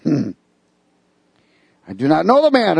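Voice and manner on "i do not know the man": mild cursing denial. Acted. Peter denying Jesus, cursing and swearing, raised voice. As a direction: snap the denial like a man caught out. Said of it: "began to curse and swear".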